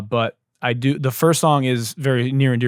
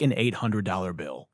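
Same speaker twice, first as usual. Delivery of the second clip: ordinary talk, nothing marked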